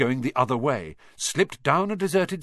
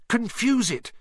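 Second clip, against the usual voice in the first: high, urgent, pleading; desperate plea; 10 semitones higher than usual. Acted. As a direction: beg your friend to act now. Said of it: "Harry said desperately".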